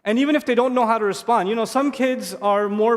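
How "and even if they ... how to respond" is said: ordinary talk, nothing marked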